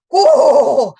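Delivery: loud, high drawn-out whoa; stunned brother's whoa; mock shocked whoa. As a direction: gasp it out in shock. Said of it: "my brother went".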